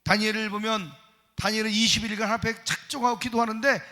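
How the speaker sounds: normal talking voice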